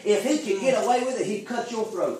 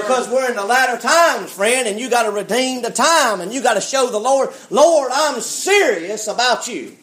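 full-force preaching; 4 semitones higher, 5 dB louder than usual. Real, not acted. Preacher urging his congregation to redeem the time, full-force preaching. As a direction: preach it out loud, stretching the big words.

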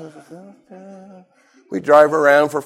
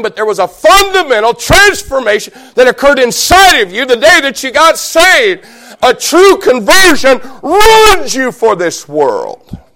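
forceful preaching; 7 semitones higher, 6 dB louder than usual. Real, not acted. Preacher urging believers beyond the usual, proclaiming forcefully. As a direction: ring it out over the congregation.

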